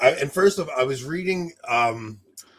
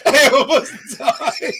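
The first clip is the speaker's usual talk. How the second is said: high, loud, slow words through laughter; really cracking up while talking; helpless laughter; 12 semitones higher, 8 dB louder, slower than usual. Real, not acted. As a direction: squeal it out, laughing hard. Cracking up at own story.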